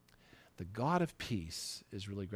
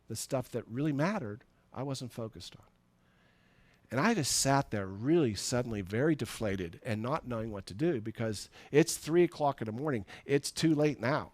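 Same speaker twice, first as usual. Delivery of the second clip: ordinary delivery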